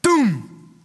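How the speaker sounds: a mock cannon boom; a big firework blast for the Spirit. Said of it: "the gates of hell hear".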